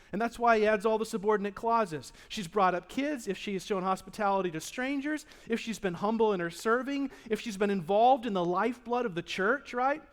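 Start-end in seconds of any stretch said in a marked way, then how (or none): none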